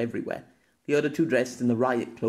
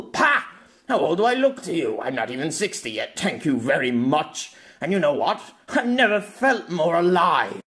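roared; 5 semitones higher, 3 dB louder than usual. Acted. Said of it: "Pierre roared".

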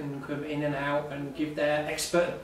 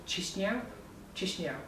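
tentative try at Chisinau, first rising like a question, then falling; hesitant, softer stab at a foreign place name; unsure pronunciation; 5 semitones higher, 9 dB quieter than usual. Real, not acted. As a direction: say Chisinau softly, unsure it's right, then repeat it. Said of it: "I say it wrong every time".

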